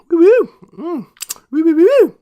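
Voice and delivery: loud high hooting of delight; excited hooting. Real, not acted. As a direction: hoot with pleasure over the food. Savoring a bite of meat.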